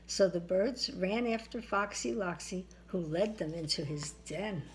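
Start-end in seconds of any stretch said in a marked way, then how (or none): none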